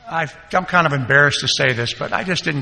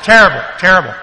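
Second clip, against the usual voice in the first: louder, weary terrible, terrible; emphatic; 4 semitones higher, 8 dB louder, faster than usual. Real, not acted. Recalling years of prison visits, loud weary emphasis. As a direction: lean hard into the words.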